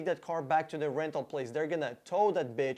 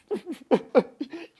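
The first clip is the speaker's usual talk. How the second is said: mocking laugh; 10 semitones higher, 6 dB louder than usual. Sarcastic laugh after rant.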